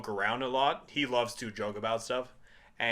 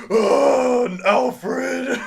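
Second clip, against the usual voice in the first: scared Batman; 12 semitones higher, 10 dB louder than usual. Retold or imitated. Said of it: "He's like".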